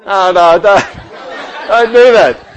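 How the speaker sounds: loud, high laughing objection; playful protest. Real, not acted. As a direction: shut down the crowd's teasing, loudly. Playfully stops audience's reaction to compliment.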